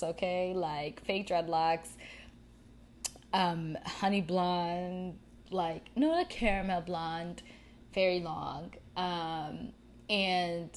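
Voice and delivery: usual speaking voice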